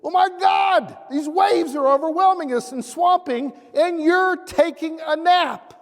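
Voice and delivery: high, loud panicked plea to a sleeping master; panicked cry. Retold or imitated. Disciples waking Jesus, a loud, high panicked cry. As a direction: cry out in alarm over the waves.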